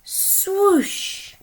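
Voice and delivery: high, loud swooping sound word; big storybook sound effect; dramatic swoosh. Acted. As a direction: whoosh it up like a gust of wind. Wind sweeps animals away.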